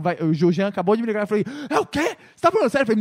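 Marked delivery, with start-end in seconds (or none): 1.7-2.8 high-pitched, disbelieving retold reaction; acted-out astonished double-check; incredulous outburst. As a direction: voice leaps up in mock shock. Retelling disbelief at the big news.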